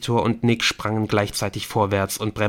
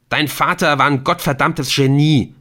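loud, higher angry outburst; shouting; 5 semitones higher, 7 dB louder than usual. A narrator's reading. Ortolan shouts at Adrian about his father. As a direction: rant at Adrian. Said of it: "rief Ortolan".

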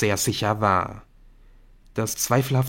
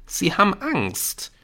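higher, earnest arguing voice; insistent schoolboy; 9 semitones higher than usual. Acted. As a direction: contradict your friend and press the case. Jamie argues back at Nick. (eindringlich widersprechender Schüler)

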